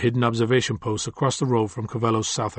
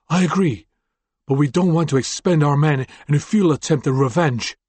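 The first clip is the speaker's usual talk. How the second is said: higher, louder, anxious report; nervous man voice; 4 semitones higher, 4 dB louder than usual. Acted. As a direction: rattle off the bad news with rising urgency. Fade Out argues with Suma.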